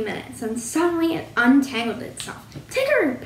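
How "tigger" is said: high, falling cry of recognition; exclaiming. A narrator's reading. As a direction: exclaim the name in high surprise. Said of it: "Pooh exclaimed".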